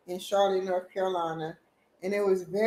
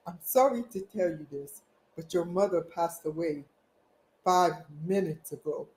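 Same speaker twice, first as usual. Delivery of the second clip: imitating the doctor's strong African accent, lower and softer; 4 semitones lower, 6 dB quieter than usual. Doctor tells son his mother passed, imitated African accent. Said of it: "Because the African strong voice".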